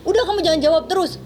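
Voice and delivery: high, imitated cut-off order; retold scolding. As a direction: act out the boss silencing the maid. Retold employer before a kick.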